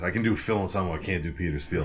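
usual speaking voice

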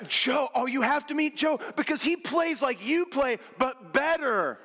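gushing, drawn-out repeated better; gushing friend. Retold or imitated. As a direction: rave about the rival, stretching each better. Friend raving about better player.

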